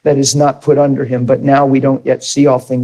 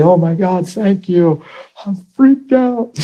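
imitating overwhelmed thanks, pitch climbing high; high, drawn-out mock freak-out at the end; 5 semitones higher, slower than usual. Healed man thanking those who prayed, freaked out. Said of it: "And he was freaked out".

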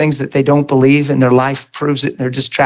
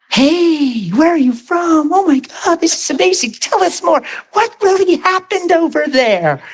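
high-pitched eager girls, imitated; gushing excitement; 14 semitones higher than usual. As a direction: squeal with curiosity, begging for the story. Acts out eager girls asking questions.

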